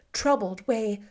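plain reading voice

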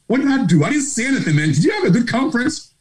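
high-pitched, bewildered self-defence; innocent protest. Retold or imitated. Husband asking his wife what he did, high-pitched innocent protest.

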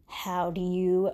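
ordinary talk, nothing marked

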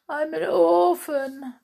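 high, loud plaintive kitten voice; sad little kitten. Acted. Kitten telling Miss Bianca it has no parents, plaintive cry. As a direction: wail it like a forlorn kitten.

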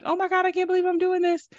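fast, high shocked cry; panicked disbelief. Retold or imitated. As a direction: gasp it out in disbelief. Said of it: "to, like".

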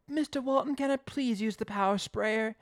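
hammed-up eager trainee asking a favour; pleading assistant. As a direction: plead like an acted keen youngster. Acting Simon pleading with boss.

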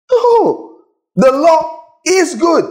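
high, slow cry of conviction; soaring preacher exclamation; exclaimed. Real, not acted. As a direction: lift it up: the law is good. Preacher exclaims the verse's point.

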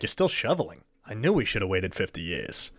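a hint of a cheap Rodney Dangerfield gripe; discount Rodney Dangerfield alien. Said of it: "my aliens sound like a discount Rodney Dangerfield".

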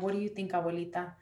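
softly retold question to grandma; gentle question. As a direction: ask quietly, leaving space to answer. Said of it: "giving her the space to process".

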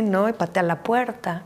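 usual speaking voice

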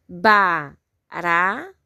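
"Barata" slowly split into ba, ra, ta; syllable by syllable. Shown as a demonstration. Teacher asking pupils to count, "Barata" syllable by syllable. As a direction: sound out "Barata" with pauses between syllables. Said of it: "Quantas sílabas?".

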